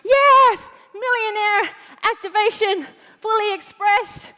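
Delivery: mock hype. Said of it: "we're all like".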